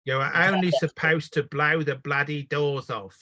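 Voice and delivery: slow Cockney Michael Caine drawl; Michael Caine impression. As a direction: do Caine's famous line, slowly. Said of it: "that's how I learned my bloody Michael Caine".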